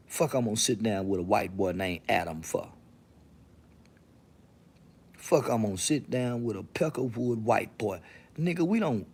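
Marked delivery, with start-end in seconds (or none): none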